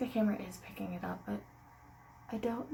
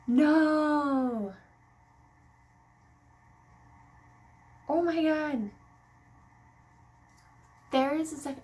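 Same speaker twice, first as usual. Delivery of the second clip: surprised; 7 semitones higher, 14 dB louder, much slower than usual. Real, not acted. Peering at a test line.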